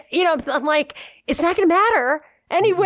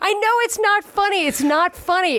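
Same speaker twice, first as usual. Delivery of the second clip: high, laughing protest that it's not funny; laughing despite himself; 8 semitones higher than usual. Real, not acted. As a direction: try to stop laughing but can't. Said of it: "you have to laugh at it because it's ridiculous".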